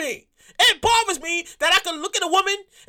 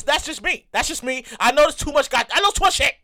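ordinary talk

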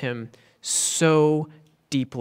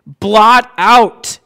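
"Blot out" said loud and hard as an order; urgent command; 3 semitones higher, 8 dB louder than usual. Shown as a demonstration.

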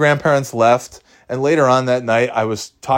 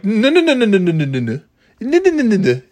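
frantic refusal; 9 semitones higher than usual. Retold or imitated. Frantically refusing to return the gift.

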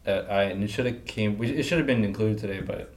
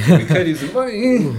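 laughing; 8 semitones higher, 9 dB louder than usual. Real, not acted. Joking about selling tickets.

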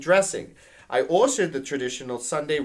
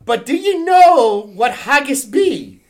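slow, loud, high-pitched mock Scottish; hammed-up Scottish, drawn out in concern; put-on Scottish accent; 9 semitones higher, 10 dB louder, slower than usual. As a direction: ask it as a worried cartoon Scot.

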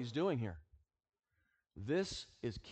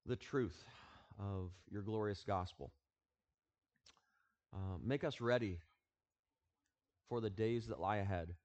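soft, reverent spoken prayer; 5 semitones lower, 5 dB quieter than usual. Acted. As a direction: pray quietly over the room. Leads the class in prayer.

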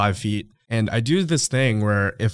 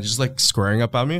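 usual speaking voice

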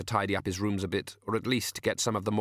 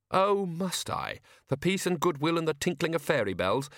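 higher-pitched, exasperated sarcasm; indignant; 7 semitones higher than usual. A narrator's reading. Susan arguing back at Death about the task, indignant and sarcastic. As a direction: protest indignantly, pitch jumping up.

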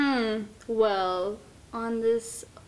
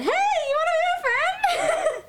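put-on overeager kid; 7 dB louder than usual. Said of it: "my dumb ass was over here in her face like".

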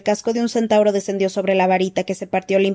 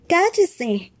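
high sharp cry of shut up; much higher desperate cry; shout; 10 semitones higher than usual. A narrator's reading. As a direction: yell to stop her, too late. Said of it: "le gritó Hermione".

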